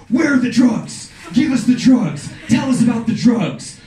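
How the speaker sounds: loud, high mock police yell; cops yelling. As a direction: bark the demands like a raiding cop. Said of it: "They're yelling".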